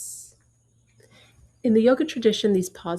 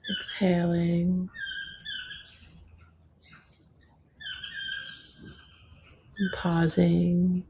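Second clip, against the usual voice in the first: gentle yoga teacher voice counting breaths; guided meditation. Acted. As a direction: ease them through each breath, unhurried.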